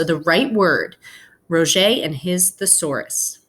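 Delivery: gentle read-aloud to the class; story narration. A narrator's reading. Teacher reading a picture book to her class, storytelling voice.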